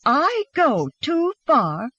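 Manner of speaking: high, slow, incredulous retort; shouting back. A narrator's reading. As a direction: echo the accusation with drawn-out outrage. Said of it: "she shouted back".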